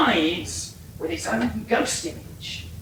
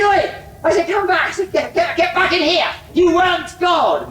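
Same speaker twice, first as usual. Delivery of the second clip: calling out angrily; 7 semitones higher, 6 dB louder than usual. Retold or imitated. Calling back the vanishing impostor.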